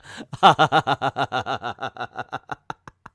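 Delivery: breathless, disbelieving laughter that rises; real helpless laughter. Real, not acted. Shocked by the racist comic line.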